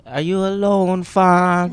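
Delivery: high, loud rap-line delivery; Toronto slang accent. Retold or imitated.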